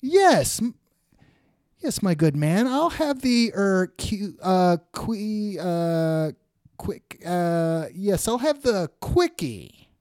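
high-pitched, halting cartoon customer; flustered diner voice. Ziggy stumbles through ordering from waiter, hammed-up fluster. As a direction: fumble for the word, then land it confidently. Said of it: "I love the voice work".

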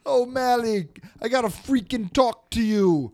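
high, loud East Coast wiseguy; hammed-up East Coast summons; East Coast Italian. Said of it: "everyone on the East Coast Italian".

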